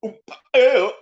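high, loud mock yelp; imitated noise. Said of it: "you know, like that".

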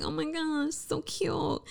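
high, soft cooing over babies; gushing coo. Retold or imitated. Imitating herself cooing at friends' kids, high and soft. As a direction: coo like you just saw a baby.

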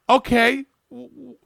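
incredulous. Real, not acted. Incredulous reaction to booking.

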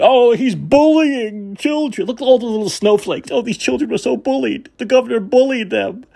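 hammed-up pearl-clutching Karen; mocking Karen. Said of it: "the Karens are triggered".

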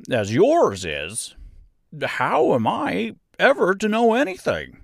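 high, slow, emphatic character voice; simple straw man airing his troubles; confident scarecrow. A narrator's reading. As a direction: explain earnestly with swooping pitch. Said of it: "he continued confidently".

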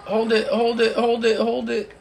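loud, high urgent command; rally the team to hold; urgent shout. Real, not acted.

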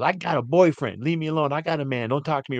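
S0 mimics the woman snapping at the man in a high voice; mock woman. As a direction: mimic her brushing him off in a high voice. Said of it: "she goes".

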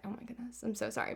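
very soft imitated apology; barely-there voice for a quoted apology; hushed mock apology. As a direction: play his apology under your breath. Voicing the crush's apology in story.

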